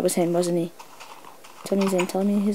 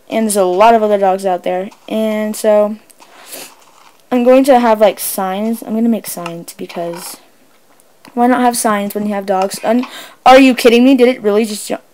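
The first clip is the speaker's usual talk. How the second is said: ordinary talk, nothing marked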